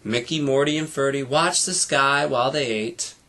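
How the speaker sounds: ordinary delivery, nothing marked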